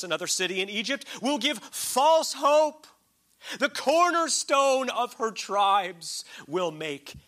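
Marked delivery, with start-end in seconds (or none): 1.2-7.1 slow, loud, high proclamation of the verse; preaching scripture. Acted. Preacher reading Isaiah's rebuke to his congregation, slow and loud. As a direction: declaim each word like a prophet.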